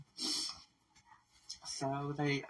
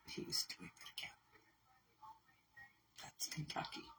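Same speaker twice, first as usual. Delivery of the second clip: bitter whisper; 4 semitones higher, 13 dB quieter than usual. Real, not acted. Bitter about abuser repeating.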